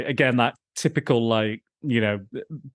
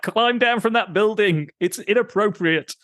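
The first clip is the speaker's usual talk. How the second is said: higher, earnest scolding quote; mock scolding; 9 semitones higher than usual. Host quoting people telling him to climb down, mock prim. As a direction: call up to him, prim and serious. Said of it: "And they're like".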